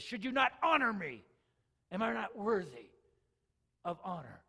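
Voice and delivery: booming divine rebuke that settles down; voice of God. Acted. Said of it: "he says".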